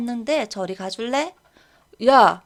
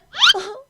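high sob-laugh; 11 semitones higher, 5 dB louder than usual. Acted. Girl breaks down, laugh-cry.

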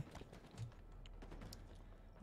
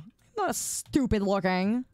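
ordinary talk, nothing marked

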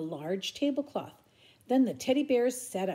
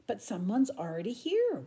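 plain reading voice

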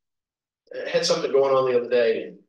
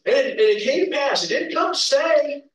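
quoting comedian; 11 semitones higher, 3 dB louder than usual. Retold or imitated. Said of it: "it was [name]".

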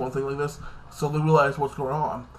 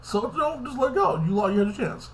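much higher, put-on sassy voice; mock sassy scolding; 9 semitones higher than usual. Reviewer scolding a jealous TV character, mock sassy and high.

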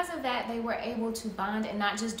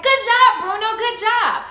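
high, loud cheer for the dog; excited praise; 10 semitones higher, 13 dB louder than usual. Acted. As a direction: praise the pup with big, high energy. Trainer praising her dog.

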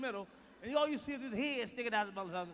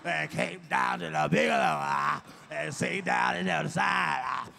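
loud, low hollering minister with gasped "ah"s; growling preacher; 5 semitones lower, 9 dB louder, slower than usual. Retold or imitated. Mocking a minister at a funeral, loud growling sermon. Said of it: "all that fucking growling and shit".

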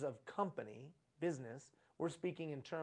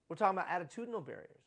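faster repeat; much faster than usual. Shown as a demonstration. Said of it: "repeated faster".

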